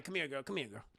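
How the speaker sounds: put-on soft, low coaxing as old Joe; low furtive beckoning. Joe calls Aunt Bam over, low coaxing. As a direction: beckon her over under your breath.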